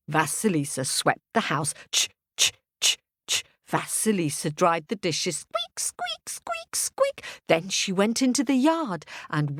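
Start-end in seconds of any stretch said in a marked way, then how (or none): 1.9-3.4 unvoiced brushing sound; broom sweeping. A narrator's reading. Sweeping the house. 5.4-7.2 very high squeaking noise; squeaky dishes. A narrator's reading. Drying dishes.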